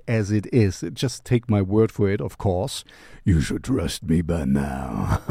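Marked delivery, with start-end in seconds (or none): 3.2-5.2 playfully sinister, very low register; mock-ominous low voice. Host telling viewers to trust his taste, mock-ominous low voice. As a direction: drop far down in pitch.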